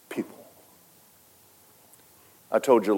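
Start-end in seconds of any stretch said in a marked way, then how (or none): 0.1-0.5 hushed whispered emphasis. Acted. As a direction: whisper the word softly. Sermon, dropping to whisper.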